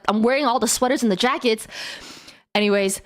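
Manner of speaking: ordinary talk, nothing marked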